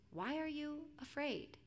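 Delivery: hushed storybook calm after the panic; calm questioning. A narrator's reading. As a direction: gently question frightened friends. Jesus calmly questioning frightened disciples.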